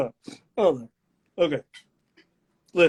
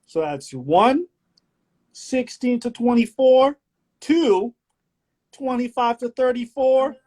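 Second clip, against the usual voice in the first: slow, showy reading of quiz options; game-show host; 9 semitones higher, 4 dB louder, much slower than usual. Acted. Host reads out the choices, slow game-show delivery.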